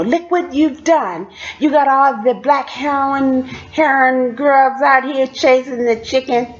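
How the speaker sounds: mock scolding, drawn out; scolding kitchen boss. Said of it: "that's what she told Piper".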